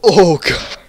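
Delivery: loud high pained cry; pained groan. Real, not acted. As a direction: groan from the burning onion. Second bite burns.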